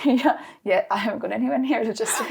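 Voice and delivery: laughing through the words; real laughter spilling into speech. Real, not acted. Laughing at own career test.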